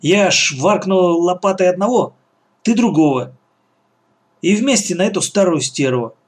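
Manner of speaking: ordinary delivery, nothing marked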